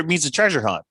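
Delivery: ordinary talk, nothing marked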